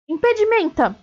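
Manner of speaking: high, urgent spell cry; berro de feitiço. A narrator's reading. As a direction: cry out the spell, pitch jumping up. Harry berra o feitiço de novo. (grito agudo de feitiço)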